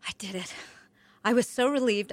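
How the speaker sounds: usual speaking voice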